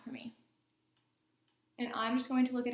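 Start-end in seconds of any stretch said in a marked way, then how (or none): 0.0-0.4 whispering. Real, not acted. Exam instruction whispered.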